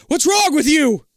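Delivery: high, loud frustrated yell. Acted. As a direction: yell at someone unresponsive. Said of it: "Liz yells".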